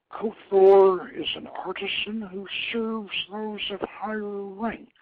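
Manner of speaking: a goddess belittling the craftsman god; haughty goddess. Acted. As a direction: talk down to him slowly. Goddess lectures the prince.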